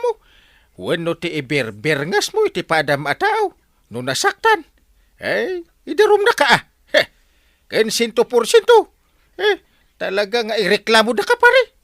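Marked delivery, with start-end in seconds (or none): 3.9-11.7 high-pitched, heated warning; indignant threat. Acted. Offended man threatens his friend with a complaint, indignant. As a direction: press the complaint, voice jumping up.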